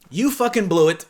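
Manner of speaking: loud exasperated scolding; exasperated outburst. Real, not acted. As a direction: let him have it in disbelief. Exasperated at guy.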